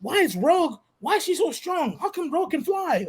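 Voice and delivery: put-on high, eager little girl full of questions; excited little girl. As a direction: play the wide-eyed kid firing off questions. Daughter voiced in the story.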